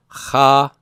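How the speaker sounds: "cha" as khah, throaty kh, standing alone; syllable-by-syllable pronunciation. Shown as a demonstration. Teacher sounding it out for class, syllable by syllable. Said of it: "Say that with me".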